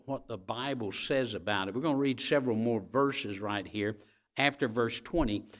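ordinary talk

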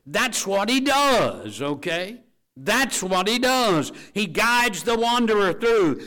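high, loud, slow refrain; preaching shout. Acted. Preacher shouting what God does to the congregation, full force.

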